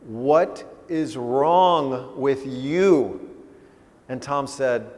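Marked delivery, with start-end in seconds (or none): 0.1-3.3 very slow, word by word; weighty, drawn-out parental question; emphatic questioning. Retold or imitated. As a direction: draw out "What is wrong with you". Tom quotes his dad's question.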